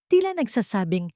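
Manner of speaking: ordinary delivery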